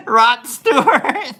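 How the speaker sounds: slow, loud, high laughing guess; the joke cracks him up as he says it; laughing out the answer. Real, not acted. Host guessing celebrity hot rods, laughing loud and slow.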